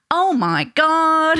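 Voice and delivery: drawn-out, loud American "Oh my God"; American accent. Retold or imitated. Mum imitating her little boy going round saying it, American accent. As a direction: imitate a child's high, slow American accent. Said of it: "does it with an American accent".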